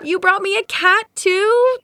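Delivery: high surprised delight. Real, not acted. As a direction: gasp in happy surprise. Surprised by gift.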